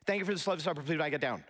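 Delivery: rattled off in one rushed, toneless run; deadpan monotone. Retold or imitated. Youngest brother reciting to his parents at dinner, deadpan. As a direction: imitate a rote, meaningless recital without a pause. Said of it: "with a monotone look on his face, just kinda deadpan".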